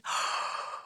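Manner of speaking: put-on big breathy yawn to show the child; demonstrated yawn. Shown as a demonstration. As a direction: open up into an audible yawn. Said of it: "can you yawn".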